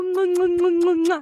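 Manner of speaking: high playful kiss sounds; blowing kisses. Real, not acted.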